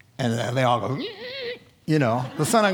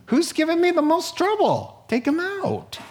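high-pitched scheming boss giving orders; put-on high voice, picking a target; Satan; 13 semitones higher than usual. Devil ordering a hit on troublemakers, scheming and high.

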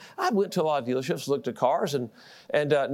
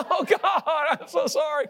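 laughing apology; 9 semitones higher, slower than usual. Retold or imitated.

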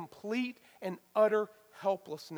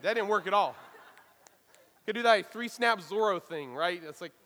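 ordinary delivery

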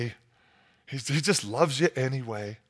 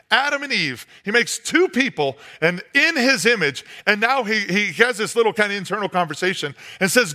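usual speaking voice

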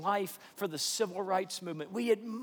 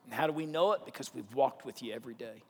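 closing prayer; 4 semitones lower than usual. Acted. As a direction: pray gently and sincerely for the whole room.

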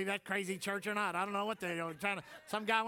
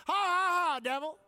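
mock laugh at the devil; 7 semitones higher, 5 dB louder than usual. Shown as a demonstration. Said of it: "You come from five generations of poverty".

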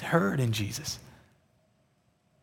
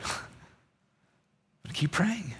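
soft laugh; 4 semitones higher, 12 dB quieter, much slower than usual. Real, not acted. Laughs at his own resolve.

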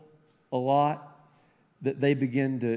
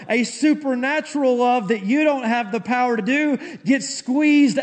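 loud, high, impassioned preacher; high, driving sermon climax; full-force preaching; 12 semitones higher, 11 dB louder than usual. Real, not acted. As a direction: preach it at the top of your voice.

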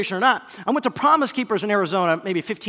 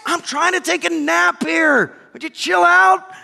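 loud, high complaint; exasperated yelling; 9 semitones higher, 7 dB louder, slower than usual. Acted. Napping concertgoer to the heckler, exasperated yell. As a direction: holler back in annoyance. Another irritated concertgoer yells back.

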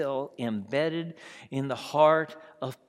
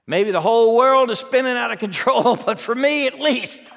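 louder, higher defiant boast; defiant self-ruler; 7 semitones higher, 6 dB louder than usual. Acted. Preacher voicing a person insisting on running their own life, loud. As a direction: claim control with a laugh.

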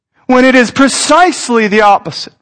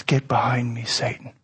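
very low, hushed command; quiet rebuke; 11 semitones lower, 14 dB quieter than usual. Acted. Jesus turning on his disciple, a low, hushed rebuke. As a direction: drop right down and rebuke softly. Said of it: "Jesus turns on him and says".

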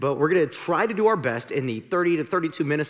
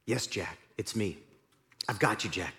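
calm God voice; 6 semitones lower, 8 dB quieter than usual. Acted. God reassures Jack.

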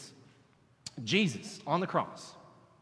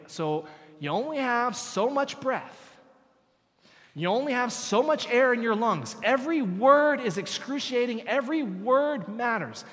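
slow, punched words about breath; emphatic preaching; 5 semitones higher, 3 dB louder than usual. Acted. Preacher stressing how precious each breath is, slow and weighty. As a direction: lean on every word.

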